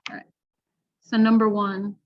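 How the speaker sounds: usual speaking voice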